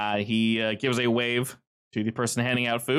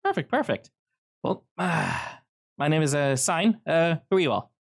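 lightly voiced Sign, higher pitched; friendly hello to newcomers, voice pitched up; Sign greeting the group; 6 semitones higher than usual.

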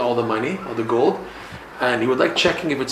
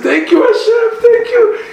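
joyful laughing thanks; 8 dB louder than usual. Acted. Couple rejoices at the gold.

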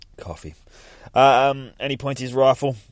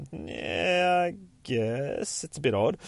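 high, drawn-out doubtful reply; hesitant agreement; 8 semitones higher, slower than usual. Retold or imitated. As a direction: stretch it up high, unconvinced. Voiced reluctant agreement in story.

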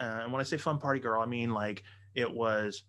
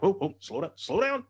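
mock alarm; 8 semitones higher, 4 dB louder than usual. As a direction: call a halt as it gets too much, pitch climbing. Acts out his overwhelmed reaction.

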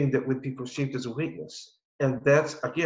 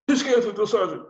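high, louder mock recruit reply; scared recruit; 10 semitones higher, 5 dB louder than usual. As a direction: blurt it up to the sergeant, acting scared. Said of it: "he actually scared me".

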